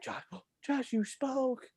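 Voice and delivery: high shocked teacher voice with a gasp. Retold or imitated. Teacher catching Josh talking, high and gasping. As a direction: gasp as if catching him red-handed. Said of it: "she was like".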